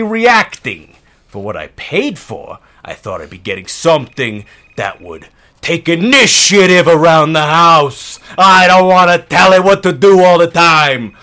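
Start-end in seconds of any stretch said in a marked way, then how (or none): 5.6-11.2 loud fed-up complaint; yelling. Acted. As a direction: raise your voice in frustration. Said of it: "Thanks for yelling".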